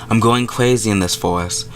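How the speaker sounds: ordinary delivery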